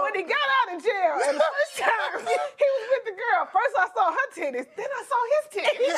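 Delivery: high laughing giggle; barely holding in laughter. Real, not acted. Laughing through setup.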